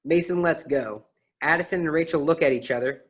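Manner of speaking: ordinary talk, nothing marked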